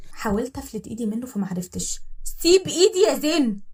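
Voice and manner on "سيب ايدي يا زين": غضب. Acted. تحاول تفلت إيدها.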